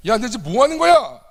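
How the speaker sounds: Jesus rebuking his disciples, angry and loud; angry rebuke. Retold or imitated. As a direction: scold the disciples hotly. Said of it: "라고 지금 화를 내시는 거예요".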